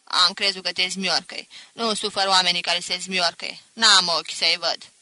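put-on loud, gruff old woman's rebuke; harsh scolding. A narrator's reading. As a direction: growl it out like a hard old grandmother. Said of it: "Zise răstit".

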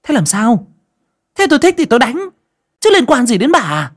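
high, loud angry retort; aggressive, defiant. A narrator's reading. Daughter-in-law points at mother-in-law's face, defiantly shouting. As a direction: shout back belligerently. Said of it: "sắn sổ hung hăng".

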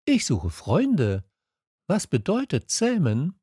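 high, questioning prince voice; little prince. A narrator's reading. Little prince asking the fox, high questioning voice.

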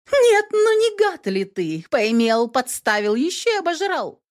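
high, outraged female voice; indignant woman. A narrator's reading. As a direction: scold him shrilly. Katherine scolds thief.

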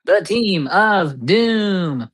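slow, high, stretched announcer call; attempted announcer voice. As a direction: try to boom it like an announcer. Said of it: "See, I can't do it".

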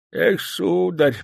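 put-on mumbling old man, slow; old prospector. A narrator's reading. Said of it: "прошамкал старик".

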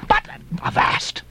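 slow hushed whisper; whispered avast. Acted. Mrs Hussey halting Ishmael, whispered. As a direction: breathe it under your breath.

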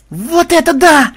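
very high, drawn-out whoop; delighted yell. A narrator's reading. Granddaughter grabbing her camera, ecstatic squeal. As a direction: squeal in rapture, slow and loud. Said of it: "в восторге завопила внучка".

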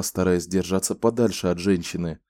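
ordinary delivery, nothing marked

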